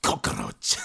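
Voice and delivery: COCKROACH with hard, drawn-out consonants; exaggerated fricatives. Shown as a demonstration. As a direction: lean into the hissing end of "cockroach". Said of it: "gotta lean into the fricatives".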